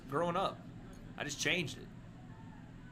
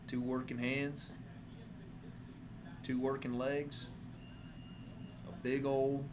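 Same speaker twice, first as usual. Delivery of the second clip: cowboy voice; 4 semitones lower, 7 dB quieter, slower than usual. Pretend. Cowboy roleplay listing virtues.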